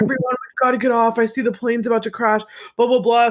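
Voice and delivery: high, hurried mock alarm; mock scene-making. Acting out a passenger making a giant scene on the plane, high mock panic. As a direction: imitate someone frantically warning the cabin. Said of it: "he just makes this giant scene".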